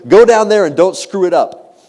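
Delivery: retold stern order.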